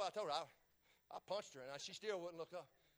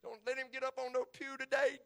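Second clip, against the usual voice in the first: ordinary delivery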